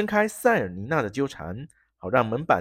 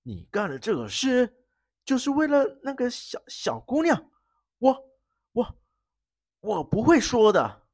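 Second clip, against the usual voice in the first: halting, stammering, high; 9 semitones higher than usual. Acted. Pale baron stammering his refusal to the prince. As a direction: stumble over the words. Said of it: "结结巴巴地说".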